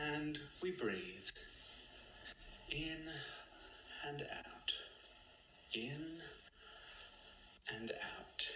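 very soft, spaced-out meditation voice; hushed cues with long gaps, fading toward a whisper; breathing guide. Pretend. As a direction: lead the breath gently and quietly. Leading slow breathing in mock meditation.

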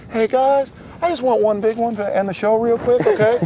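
high-pitched impersonation voice; Mike McKinnon. Acted. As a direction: do the impression high and put-on. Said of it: "sounds just like Mike McKinnon".

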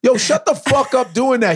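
high annoyed. Retold or imitated. Annoyed reply to troll.

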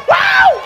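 very high, loud "woo"; ecstatic whoop. Real, not acted. Preacher overcome in praise before congregation, ecstatic whoop. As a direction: let out a piercing church whoop. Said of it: "I got one more in me".

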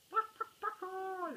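high mocking chicken clucks; shouted clucks. The gang taunting Brother again, high chicken clucks. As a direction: cluck at him like hens. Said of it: "they shouted".

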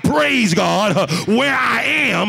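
loud testimony with rhythmic punctuating breaths; full-force preaching. Real, not acted. Preacher praising God for blessings, fervent full-force preaching. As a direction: drive each phrase home to the congregation.

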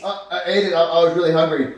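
high, loud, stammered confession; guilty kid character. Acted. Junior explains missing cheese to the chef, guilty. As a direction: own up in a high voice.

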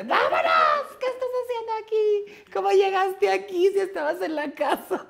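very high, loud scolding; high shrill mock scolding with a laugh; scolding sisters. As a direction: shriek at the little sister.

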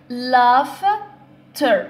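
"laughter" in two beats, long gap before ter; split into syllables. Shown as a demonstration. Teacher showing two syllables, "laughter" pulled apart. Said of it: "عندنا two syllables".

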